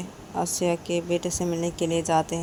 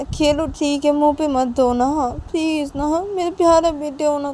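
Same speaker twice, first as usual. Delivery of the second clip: put-on coaxing child; 7 semitones higher, 5 dB louder than usual. Mother coaxes child sweetly.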